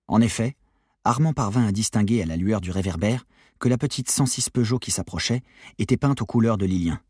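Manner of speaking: ordinary delivery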